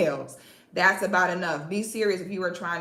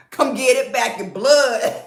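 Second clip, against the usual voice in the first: hyped taunt; 4 semitones higher, 5 dB louder than usual. Acted.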